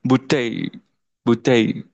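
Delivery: బుట్టై with the double L said as ya; French bouteille. Shown as a demonstration. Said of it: "double ఎల్ని యా కింద pronounce". (బుట్టై యా శబ్దంతో)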